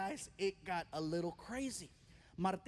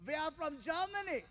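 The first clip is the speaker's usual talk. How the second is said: put-on German accent; 6 semitones higher than usual. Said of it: "he goes".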